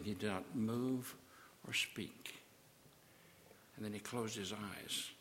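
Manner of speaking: soft, almost breathless storytelling; hushed storyteller; hushed narration. A narrator's reading. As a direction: draw the listener in close. Narration falls to near whisper.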